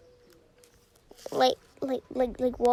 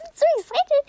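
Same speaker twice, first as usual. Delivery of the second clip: high and louder burst of glee; excited; 12 semitones higher, 7 dB louder, much faster than usual. Real, not acted. Gamer bursts out to viewers about the horse, high and excited. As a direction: squeal it with delight. About to claim game reward.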